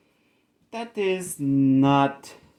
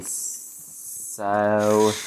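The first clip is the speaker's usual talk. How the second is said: ordinary delivery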